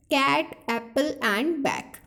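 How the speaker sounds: 'cat, apple and bag' spelled out slowly with a flat short a vowel; drawn-out classroom demo of 'cat, apple and bag'; teaching short a. Shown as a demonstration. Teacher showing students the short a sound, slowly. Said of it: "For example".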